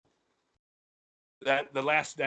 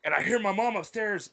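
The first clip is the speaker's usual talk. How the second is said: ordinary talk, nothing marked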